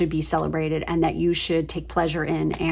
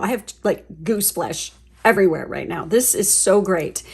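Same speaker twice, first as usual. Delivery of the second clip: ordinary talk, nothing marked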